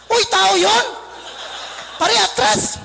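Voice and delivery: hammed-up scolding driver; driver calling out. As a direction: call out as if nearly running someone over. Driver yells at ugly would-be passenger.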